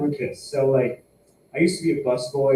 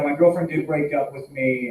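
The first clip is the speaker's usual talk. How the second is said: ordinary delivery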